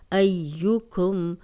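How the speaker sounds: ایوکم with the یا said twice, ay-yu; slow recited demo. Shown as a demonstration. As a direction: recite ایوکم slowly, doubling the یا. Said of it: "اب یا کو دو بار پڑھیں گے". (ایوکم، یا کو دو بار پڑھ کے)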